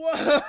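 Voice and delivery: loud, very high drawn-out howl; shocked whoop. Real, not acted. Reacting to the explicit text, shocked falsetto howl.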